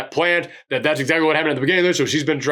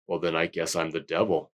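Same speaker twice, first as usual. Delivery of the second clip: very low, hushed devil voice; old Japanese man; 12 semitones lower, 9 dB quieter than usual. Acted. Reviewer voicing the old man's answer to the deacon, very low and hushed. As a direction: murmur it deep and quiet. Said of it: "And he goes".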